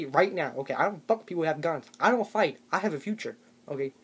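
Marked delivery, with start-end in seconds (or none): none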